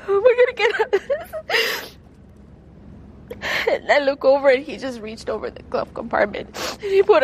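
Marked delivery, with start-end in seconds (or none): 0.1-2.0 fighting back real tears while reliving the memory; real crying. Real, not acted. Woman retelling her terror to the interviewer, crying. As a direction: voice high and tearful, breaking as she speaks. Survivor retelling being trapped in car.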